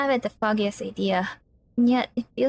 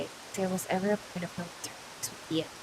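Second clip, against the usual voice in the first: hushed; 10 dB quieter than usual. A narrator's reading. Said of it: "Eddie gave a look as if to say".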